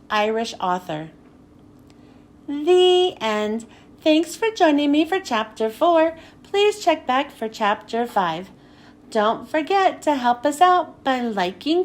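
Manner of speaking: ordinary delivery, nothing marked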